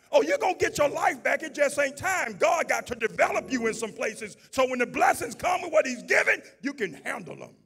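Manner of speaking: loud, high, driving sermon climax; full-force preaching. Acted. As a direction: lift the church with every shout.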